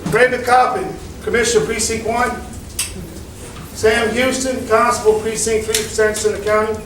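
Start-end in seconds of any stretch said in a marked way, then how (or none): none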